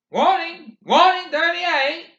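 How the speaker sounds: high, loud barked warning; official announcement. A narrator's reading. Soldier on the half-track, loud official warning. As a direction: call it out like a soldier.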